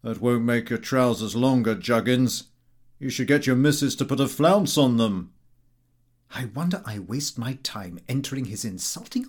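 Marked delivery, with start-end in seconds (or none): 0.0-5.3 loud, jeering friend; brash teasing voice; rude Gowing. A narrator's reading. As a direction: bark it out like a mocking chum. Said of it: "He said".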